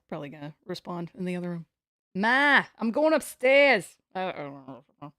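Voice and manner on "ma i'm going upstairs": slow, dropped-R Boston accent. Shown as a demonstration. Streamer practising a Boston accent for chat. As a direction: say it like a Bostonian calling upstairs. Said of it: "Never pronounce your ass".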